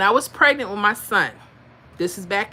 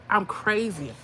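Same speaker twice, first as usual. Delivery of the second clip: usual speaking voice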